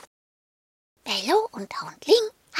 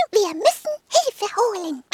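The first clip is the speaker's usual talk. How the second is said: higher, barking little dog; 8 semitones higher, 3 dB louder than usual. Acted. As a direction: bark it out, higher. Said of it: "bellte Hundling".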